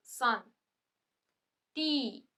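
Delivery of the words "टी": usual speaking voice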